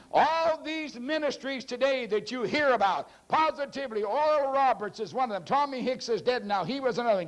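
high-pitched, driving preacher delivery; sermon proclamation pitched well up; impassioned preaching. Real, not acted. Preaches in raised voice.

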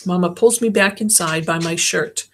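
plain reading voice

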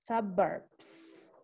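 "suburbs" said slowly as "sa-berbs", open first vowel; careful suburbs. Shown as a demonstration. Teacher answering a student's pronunciation question, careful suburbs. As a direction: spell out "suburbs" syllable by syllable, softly. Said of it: "se pronuncia".

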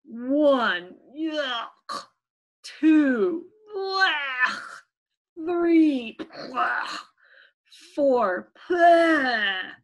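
loud count with yucky retching noises; disgusted counting. Acted. Little P choking down hated candy, acted disgust. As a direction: count each bite, then gag out the disgust.

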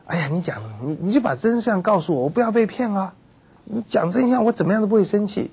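mock-earnest promise not to get angry; pleading insistence. Listener begging for the truth, eager and pleading.

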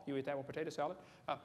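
ordinary talk, nothing marked

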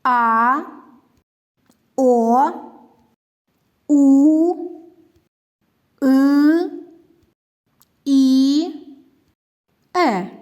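each vowel held out on its own; teacher demonstrating vowel sounds one by one; letters named singly. Shown as a demonstration. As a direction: sound out А, О, У, И, Е as long, separate vowels. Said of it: "назвіть букви".

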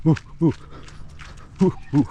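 short huffing grunts, out of breath; panting while jogging. Real, not acted. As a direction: puff it out mid-jog.

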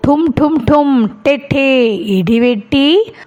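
slow, drawn-out imitation of thunder booming; thunder sound. Shown as a demonstration. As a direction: boom it out slowly like thunder for the kids. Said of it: "എങ്ങനെയാ ഇടിവെട്ടിയത്?".

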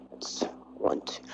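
unvoiced counting whisper. Real, not acted. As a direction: count under your breath. Counting players under breath.

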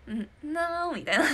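a loud, slow, nasal "なー" broken by laughter; Kano impression. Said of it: "モノマネ". (かのさんの真似で笑いながら鼻声の「なー」)

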